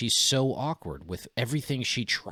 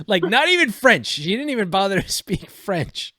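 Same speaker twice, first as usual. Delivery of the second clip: incredulous; 10 semitones higher, 6 dB louder than usual. Real, not acted. Mocking Kamala Harris's fake accent.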